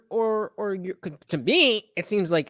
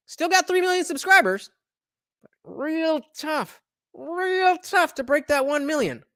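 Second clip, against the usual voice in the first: sarcastic jab; 7 semitones higher than usual. Real, not acted. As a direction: fake sympathy, rubbing it in.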